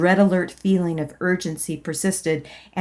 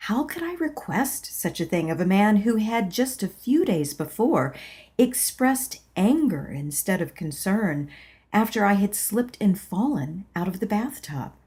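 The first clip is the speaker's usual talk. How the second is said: usual speaking voice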